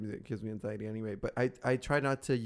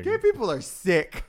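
mock outrage; 7 semitones higher, 8 dB louder than usual.

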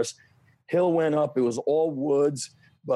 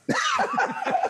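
very high-pitched burst of laughter. Real, not acted. Laughing at his own dead cat story, high-pitched laugh.

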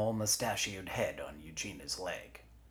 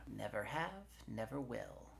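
higher, softer quick comeback; offhand retort; 5 semitones higher, 7 dB quieter than usual. Acted. Forearm answers Eugenia's warning, offhand and soft. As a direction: toss it back lightly.

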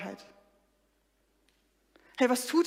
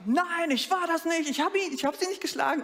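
a mock yell of a boy's denial, higher and slowed; retold shouting denial; 6 semitones higher than usual. The boy shouts at the teacher, a mild mock yell. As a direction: cry out the denial like the accused boy. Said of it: "kommt er zu mir und schreit".

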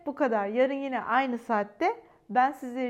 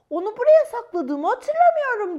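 high, loud little squirrel voice; puzzled squirrel, pitched way up; bright-eyed critter wondering out loud; squirrel thinking aloud; 10 semitones higher, 11 dB louder than usual. Acted. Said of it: "diye düşündü".

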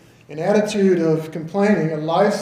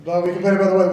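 ordinary delivery

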